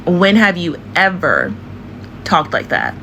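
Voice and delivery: drawn-out EVER, pause before TALKED; slow, emphatic question. Shown as a demonstration. Challenging an actress over her accent, slow and emphatic.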